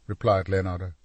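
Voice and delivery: usual speaking voice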